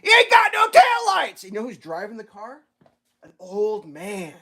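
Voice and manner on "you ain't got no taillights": very high, slow yelled warning; shouting to the driver through the window; screaming. Retold or imitated. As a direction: scream it across the passenger seat. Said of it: "I start screaming".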